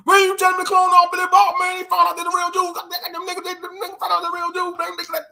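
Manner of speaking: very high, frantic hillbilly caricature; high-pitched mock hillbilly tirade; hammed-up hillbilly. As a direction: sputter in outrage like a cartoon hillbilly. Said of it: "two hillbillies".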